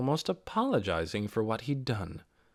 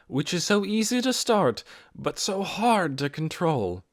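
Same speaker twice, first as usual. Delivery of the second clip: formal speech; 5 semitones higher than usual. A narrator's reading. Mary's closing address.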